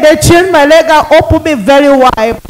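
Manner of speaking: usual speaking voice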